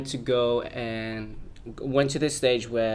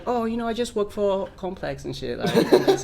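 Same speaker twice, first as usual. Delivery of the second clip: put-on American accent, pitched up; 8 semitones higher than usual. Bluffing a fan at a show in a put-on American accent. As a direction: lightly imitate an American talking casually. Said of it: "an American accent".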